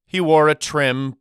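plain reading voice

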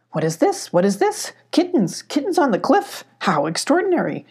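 high, amazed goat voice, jumping up on kittens; a fussy old goat who can't believe his eyes; lightly voiced goat, high with wonder; excited mountain goat. A narrator's reading. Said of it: "said a voice".

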